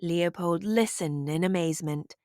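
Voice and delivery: usual speaking voice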